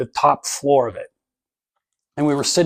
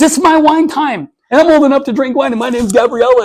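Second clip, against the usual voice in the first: put-on little girl voice; 10 semitones higher, 6 dB louder than usual. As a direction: play a five-year-old proudly showing off. Daughter's wine video retold.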